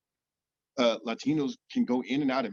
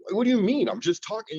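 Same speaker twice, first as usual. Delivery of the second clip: high, louder mock-defensive protest; retold puzzled protest; 9 semitones higher, 4 dB louder than usual. Answering people who question how he talks, high defensive protest. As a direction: protest it, pitched well up, in careful everyday speech. Retelling his protest at being challenged.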